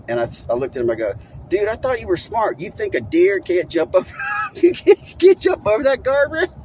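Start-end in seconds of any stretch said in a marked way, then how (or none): 1.5-6.5 high, loud disbelieving yell breaking into a laugh; incredulous shout. Retold or imitated. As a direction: shout at a friend who thinks a deer can't jump a guardrail. Mocking friend's reckless logic.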